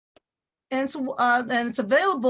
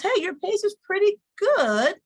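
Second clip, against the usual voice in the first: higher, chirpy software coach voice; app voice; 8 semitones higher than usual. Retold or imitated. Teacher imitating PowerPoint's coach, upbeat app voice. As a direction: voice the app's friendly feedback. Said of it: "It says".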